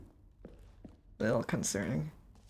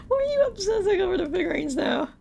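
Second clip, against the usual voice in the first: high, whiny exasperated plea; exasperated whine; 13 semitones higher, faster than usual. Real, not acted. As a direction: whine it in disbelief. Exasperated at narrator's obsession.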